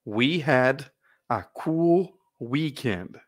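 "We had a cool weekend" drawn out word by word; very slow model reading. Shown as a demonstration. Teacher modeling the sentence very slowly for students to repeat. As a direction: spell each word out slowly for the class to repeat. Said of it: "Mais uma vez".

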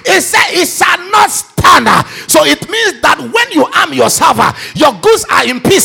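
shouted fiery prayer, every line hammered; full-force preaching. Acted. Preacher leading the congregation, shouting prayer at full force. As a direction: pray over the church at the top of your lungs.

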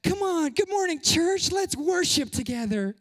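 rally the room like a worship leader; worship leader hype. Retold or imitated. Pastor mimicking a leader greeting the church, hyped. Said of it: "and go".

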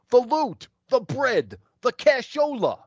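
high mock tough-guy voice, laughing at the end; hammed-up thug. Thug demands money from Jeff.

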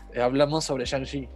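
usual speaking voice

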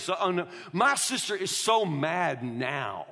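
ordinary talk, nothing marked

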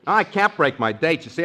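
normal talking voice

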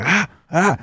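put-on high open-mouthed baby "ah"; high imitated baby noise; imitated baby straining. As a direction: mimic a baby trying to bite. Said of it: "she opened her mouth and she'd be like".

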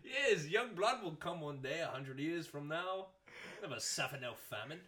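put-on slow, singsong accent, English sliding into Irish; bad English accent. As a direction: badly fake an English accent, drawn out. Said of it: "that was such a bad English accent".

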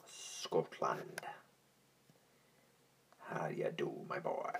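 put-on whispered Scottish accent, breathy and hushed; a hushed Scottish greeting to a lad. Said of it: "[name]'s got a damn good Scottish accent".

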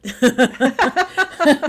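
bright high burst of laughter after her own joke; real laugh. Real, not acted. Guest laughing at her nickname to the interviewer, a real laugh. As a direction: laugh out loud at yourself.

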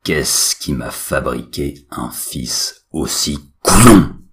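loud, slow almighty father; booming, deliberate voice of God; deep, firm roar. A narrator's reading. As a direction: thunder it slowly at your useless son. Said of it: "rugit d'une voix grave et ferme".

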